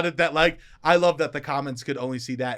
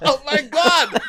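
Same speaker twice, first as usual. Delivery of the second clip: excited; 9 semitones higher, 8 dB louder than usual. Real, not acted. Excited praise after British accent.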